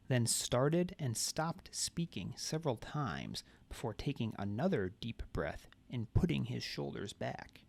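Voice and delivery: ordinary delivery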